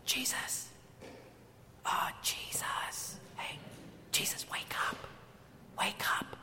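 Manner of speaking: mock-gentle whispered wake-up call; timid whisper. Disciples timidly waking sleeping Jesus, exaggerated whisper. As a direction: whisper very softly, as if afraid to wake him. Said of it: "they didn't come over and say".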